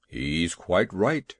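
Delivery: deep, slow elephant agreeing; slow and heavy, like an elephant. A narrator's reading.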